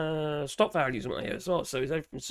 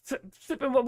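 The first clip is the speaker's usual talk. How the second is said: ordinary talk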